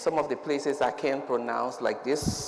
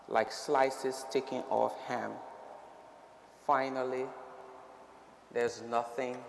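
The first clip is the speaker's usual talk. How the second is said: solemn formal speech. Acted.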